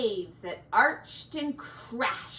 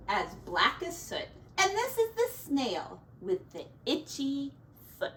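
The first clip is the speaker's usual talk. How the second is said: usual speaking voice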